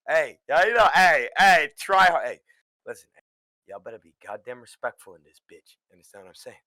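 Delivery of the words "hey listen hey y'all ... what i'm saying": stern warning. Acted.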